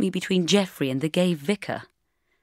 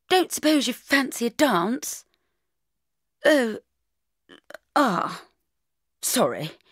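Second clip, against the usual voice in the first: higher, hesitant schoolboy voice; public schoolboy; 5 semitones higher, slower than usual. A narrator's reading. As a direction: play an awkward public schoolboy asking for a dance. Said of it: "an attractive public schoolboy type".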